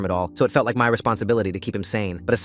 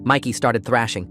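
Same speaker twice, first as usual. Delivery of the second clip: plain reading voice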